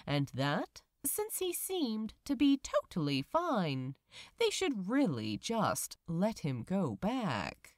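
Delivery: measured, gentle audiobook reading; story narration. A narrator's reading. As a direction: tell the story like a bedtime tale. Reads the fanfiction chapter aloud.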